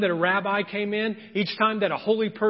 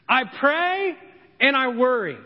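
ordinary delivery

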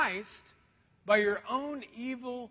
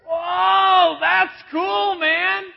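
high, loud, thrilled cry at the bait; excited fish; 10 semitones higher, 13 dB louder, much slower than usual. Acted. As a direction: shout it like a fish that just spotted something shiny. Fish spots the bait, preacher voices it.

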